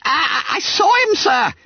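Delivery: high, halting squeal; babbling with terror. A narrator's reading. Terrified pupil admitting to schoolmaster what he saw, stammering. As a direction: stammer it out in fright. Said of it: "He was fairly babbling with terror".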